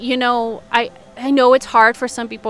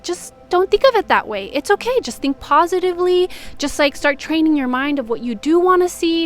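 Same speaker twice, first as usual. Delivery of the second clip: high-pitched, placating imitation of glib advice; mock positivity adviser; 6 semitones higher than usual. Positive thinker soothing an emotional person, mock-sweet dismissal. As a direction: parody someone brushing feelings off with cheer.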